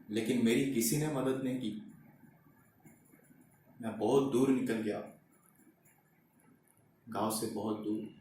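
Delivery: low, hushed and despondent; dejected. Acted. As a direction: let the voice sink softly into defeat. Father recalls being refused help.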